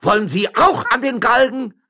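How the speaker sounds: high, loud furious shouting; furious bellow. Acted. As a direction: roar beside yourself with rage. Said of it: "brüllte der Stadtrichter ganz außer sich".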